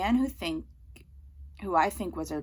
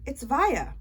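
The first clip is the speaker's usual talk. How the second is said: plain reading voice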